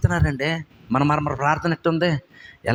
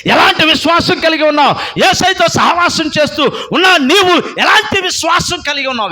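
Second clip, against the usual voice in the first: higher, louder preaching at full force; fiery preaching; 12 semitones higher, 6 dB louder than usual. Real, not acted. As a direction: exhort the congregation with fire. Preacher challenging listeners.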